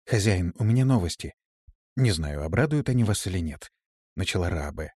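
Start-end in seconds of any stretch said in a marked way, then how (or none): none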